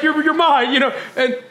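usual speaking voice

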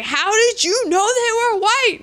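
pushy cop yelling a question; mock yell. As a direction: shout the question at the junkie. Coffin Ed shaking the junkie.

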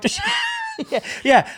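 bursting into high laughter; real laughter. Real, not acted. Laughing at the joke.

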